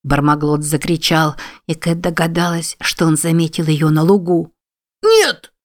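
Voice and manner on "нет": very high, loud scream of no; wailing cry. A narrator's reading. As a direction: howl it out in panic. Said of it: "завопил заяц".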